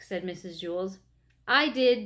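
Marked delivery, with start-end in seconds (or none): 1.5-2.0 a louder, firm declaration; declaring. A narrator's reading. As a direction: own up boldly, chin up. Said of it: "declared Damien".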